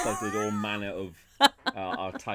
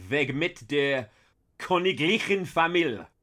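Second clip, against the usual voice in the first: higher, louder German proclaiming; 6 semitones higher, 5 dB louder than usual. Shown as a demonstration. German, announced for Canadian boy Ryan. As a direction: declaim it in German. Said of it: "in German".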